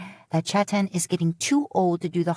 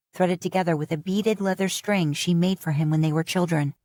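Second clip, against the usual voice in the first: usual speaking voice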